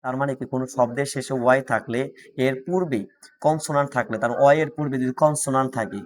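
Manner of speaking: usual speaking voice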